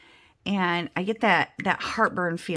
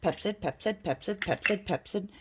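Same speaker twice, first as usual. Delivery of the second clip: softer, repeating it like a mantra; muttering to self; 5 dB quieter than usual. Retold or imitated. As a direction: mutter it over and over to remember. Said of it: "I'm repeating to myself over and over".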